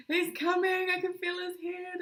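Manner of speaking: put-on high, loud cry that the baby is coming; retold labour panic. Woman bent over outside the hospital, crying out high and loud.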